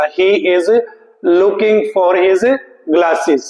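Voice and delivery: the right answer, very slow; slow answer read. Shown as a demonstration. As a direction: lay out the answer word by word. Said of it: "सही जो answer है". (सही उत्तर बहुत धीरे पढ़ना)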